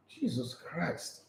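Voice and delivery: slow, near-silent groan of disbelief; hushed, drawn-out Jesus Christ; exasperated sigh. Real, not acted. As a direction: breathe it out in despair, barely voiced. Exasperated at Maguire's defence.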